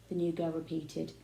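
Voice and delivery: plain reading voice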